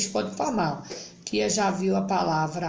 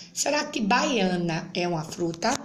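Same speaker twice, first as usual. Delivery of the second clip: normal talking voice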